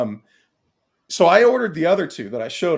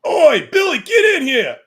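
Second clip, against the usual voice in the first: cheeky British toy; 11 semitones higher, 9 dB louder than usual. Pretend. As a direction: play a rowdy British action figure. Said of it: "he's like".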